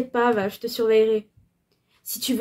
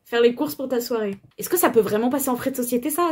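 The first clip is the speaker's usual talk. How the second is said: normal talking voice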